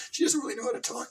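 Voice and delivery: ordinary talk, nothing marked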